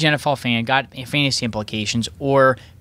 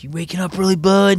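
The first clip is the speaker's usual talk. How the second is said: mock coworker; 8 semitones higher, 3 dB louder than usual.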